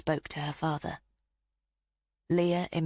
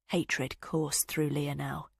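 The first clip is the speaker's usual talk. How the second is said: ordinary delivery, nothing marked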